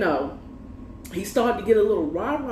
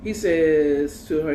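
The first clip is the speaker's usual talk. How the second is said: ordinary talk, nothing marked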